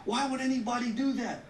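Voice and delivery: mock outraged public. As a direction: play baffled bystanders who can't believe it. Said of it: "People say".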